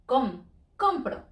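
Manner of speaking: compro stressed on COM; slowed COM-pro, first syllable leaned on; careful teaching compro, stress up front; stress on next to last. Shown as a demonstration. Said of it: "We stress next to last".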